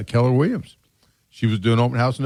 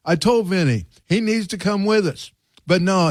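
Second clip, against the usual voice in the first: higher, a lightly put-on New York Italian accent; 8 semitones higher than usual. A guy at the shoe shine talks about Vinny in a lightly put-on New York Italian accent. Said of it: "with that strong accent like that".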